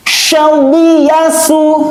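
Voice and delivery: high, drawn-out chanting of the syllables; Quran recitation. Shown as a demonstration. Teacher recites for pupils to copy, high and drawn out. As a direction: chant it like a tajwid teacher showing the sound. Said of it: "Harus gitu".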